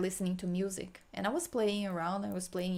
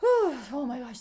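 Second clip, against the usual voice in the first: overwhelmed with sadness; 5 semitones higher, 7 dB louder, slower than usual. Real, not acted. Healer reliving a childhood memory, overwhelmed, crying out loud. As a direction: break out as the pain of the memory floods in. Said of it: "so much sadness".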